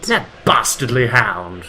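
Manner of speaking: slow, hammed-up posh English accent; a Japanese guy faking an English accent; fake English accent. Fake English accent, mocking a Victorian gent. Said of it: "doing a fake English accent".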